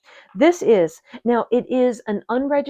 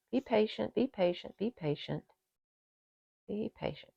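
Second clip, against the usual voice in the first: murmured to herself, fading softer and softer; patient murmur; 11 dB quieter than usual. Real, not acted. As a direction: coax yourself along, trailing off. Coaxing tissue off a bud.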